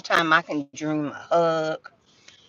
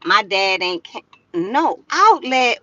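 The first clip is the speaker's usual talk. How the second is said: ordinary talk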